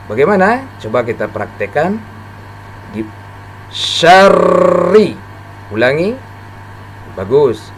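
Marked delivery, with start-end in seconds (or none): 3.7-5.2 SYARRI with a long, pressed double r; doubled, pressed r. Shown as a demonstration. Teacher demonstrating tasdid to learners, pressed double r in syarri. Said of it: "ditekan ketika membacanya".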